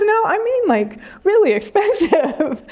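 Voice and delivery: high, laughing retelling of the teacher; laughing through a quote. Retold or imitated. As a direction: giggle through the words. Teacher's reply retold, laughing.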